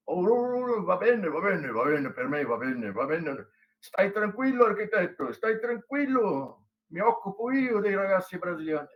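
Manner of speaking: "stai tranquillo" sung out like an operatic Italian professor; Italian tenor impression. Imitating the Italian professor to the students, lightly tenor-like. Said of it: "entonação de voz típica de tenor italiano".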